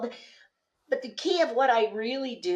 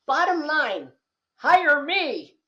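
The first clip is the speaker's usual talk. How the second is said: louder, a bit higher hammed-up hard sell; mocking salesy pitch; 6 semitones higher, 5 dB louder, slower than usual. Mimicking people who pitch "hire me" bluntly, hammed-up and loud. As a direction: parody a pushy pitch. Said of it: "Some people will go".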